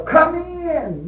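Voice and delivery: high, singing-out invitation; welcoming call, imitated. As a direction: call a visitor in on a high note. Repeats the acted invitation.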